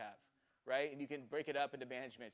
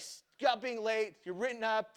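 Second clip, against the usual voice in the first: slow, higher, louder mock scolding; upset scolding; 6 semitones higher, 7 dB louder, slower than usual. Manager scolding a late server, hammed-up upset. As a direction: drag out a fed-up boss's reprimand. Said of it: "like, getting upset".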